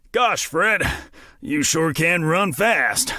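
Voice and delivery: slow, lightly panting remark; mildly winded sheriff catching his breath. A narrator's reading. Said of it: "panting and out of breath".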